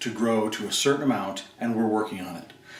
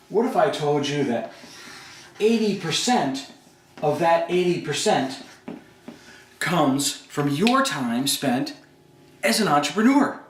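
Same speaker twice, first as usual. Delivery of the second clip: slow "What if I told you", then "eighty percent" jumps high and is stretched out; emphatic build; 4 semitones higher than usual. Shown as a demonstration. Coach to business owners, pressing the point with slow high stress on "eighty". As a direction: lean hard on "eighty" with a high, slow lift. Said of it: "put some emphasis on it".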